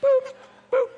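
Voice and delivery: high, loud electronic beep sounds; high beeping game noise; mock Pong beeps. Shown as a demonstration. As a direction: imitate the blips of an old video game. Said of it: "Do that again".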